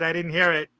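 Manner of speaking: ordinary talk, nothing marked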